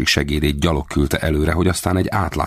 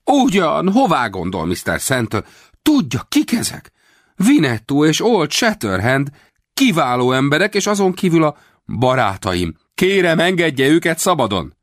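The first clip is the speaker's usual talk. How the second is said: high, loud hammed-up pleading; mock plea; 12 semitones higher, 3 dB louder than usual. A narrator's reading. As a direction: beg for the captives theatrically. Rawlins pleading falsely. (színlelt könyörgés)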